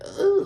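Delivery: high-pitched imitated "ugh"; short, squeaky mock groan of disgust. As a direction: imitate a grossed-out reaction, way up high. Imitating a disgusted side-eye reaction.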